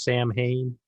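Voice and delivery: Samhain said as SAM-hayn; Samhain as spelled, SAM then hayn; English pronunciation. Shown as a demonstration. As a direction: say Samhain slowly, with the m and h sounded. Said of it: "in English, it's pronounced".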